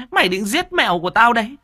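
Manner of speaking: fast, high screeching accusation rising on mày; furious stepmother shriek. Acted. Stepmother accusing the boy of killing her cat, fast rising shriek. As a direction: rattle it off fast and high, voice climbing.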